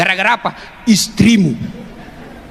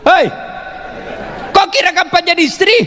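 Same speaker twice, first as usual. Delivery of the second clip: scolding husbands; 10 semitones higher than usual. Acted. Preacher scolds husbands loudly.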